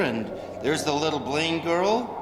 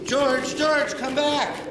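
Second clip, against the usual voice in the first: high, pleading call after someone running off; calling after him; 9 semitones higher, slower than usual. Acted. Angel calls after George as he runs off, high pleading.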